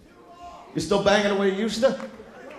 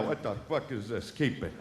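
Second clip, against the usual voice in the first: much softer, lower, dismissive; wife dismissing; 9 semitones lower, 13 dB quieter than usual. Retold or imitated. Wife brushing off husband's advance, much softer. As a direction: throw it away under your breath.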